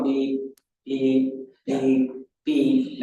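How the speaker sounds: slow, evenly spaced imitated beeps. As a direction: beep slowly like the old crossing signal. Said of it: "instead of going".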